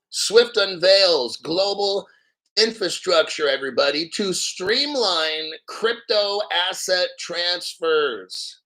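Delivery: headline read slowly, each word pressed; triumphant slow re-read; slow emphatic re-read. Shown as a demonstration. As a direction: hammer the headline home. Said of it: "I show you this article here".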